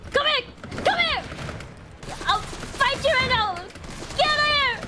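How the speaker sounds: high, heated yelling; fighting shout. Real, not acted. Player daring an enemy to fight right now, shouting.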